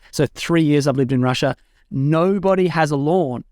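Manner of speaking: ordinary talk, nothing marked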